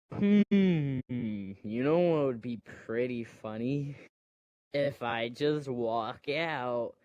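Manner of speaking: slow, stretched musing; drawn-out pondering. Retold or imitated. Rey before leaving on the ship, drawn-out pondering. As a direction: draw it out like a playful idea forming. Voicing Rey having an idea.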